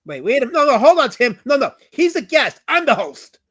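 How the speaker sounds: high, loud indignant objection; mock-outraged host asserting himself; loud protest. Real, not acted. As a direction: put him in his place, loudly.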